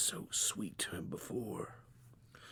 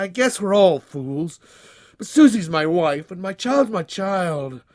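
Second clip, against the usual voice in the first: higher, louder anguished protest; in agony; 9 semitones higher, 7 dB louder than usual. Acted. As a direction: defend yourself in anguish. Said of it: "said Johnny Pie in his agony".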